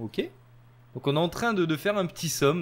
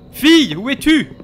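calling out; 7 semitones higher, 10 dB louder than usual. Pretend. Calling out for daughter.

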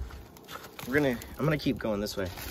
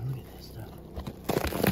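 slow, breathy whisper; almost whisper it in wonder; quiet marvelling; hushed awe; 7 dB quieter, much slower than usual. Real, not acted.

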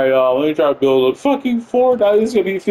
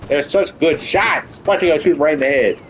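ordinary delivery

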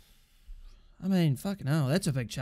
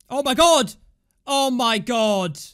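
loud, high yell, then drawn out slower; shocked outburst; 3 semitones higher, 6 dB louder, slower than usual. Real, not acted. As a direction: cry out in disbelief at the block. Reacting to a shocking block.

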